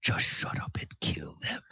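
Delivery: harsh whisper; whispered order. Acted. Glassstaff whispering orders to his bugbear. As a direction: hiss the order under your breath. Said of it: "Glassstaff is like".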